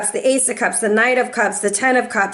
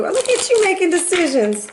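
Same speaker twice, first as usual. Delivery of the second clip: teasing; 7 semitones higher than usual. Pretend. Teasing praise.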